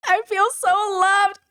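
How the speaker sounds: high, melting voice; moved aunt watching the kids; touched. Retold or imitated. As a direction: say it as if your heart is melting. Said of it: "I was like".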